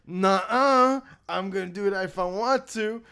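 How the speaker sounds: hammed-up playground retort; childish mock defiance. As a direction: sass it back like a stubborn child. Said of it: "It was like".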